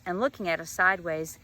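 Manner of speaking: usual speaking voice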